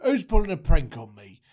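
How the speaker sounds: lightly voiced Moses, mostly low; suspicious Moses. Moses asking who is pranking him, low and suspicious. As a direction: play a wary bloke smelling a prank.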